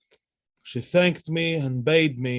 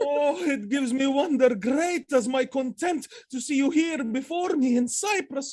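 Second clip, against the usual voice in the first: overjoyed; 10 semitones higher than usual. Acted. Othello rejoices at the reunion.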